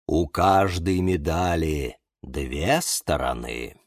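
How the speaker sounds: low, slow musing; thoughtful. A narrator's reading. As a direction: ponder it slowly, like a sage. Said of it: "задумчиво произнёс Бонсай-покусай". (задумчиво, низко и медленно)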